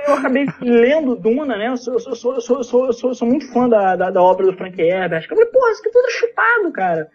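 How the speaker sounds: ordinary delivery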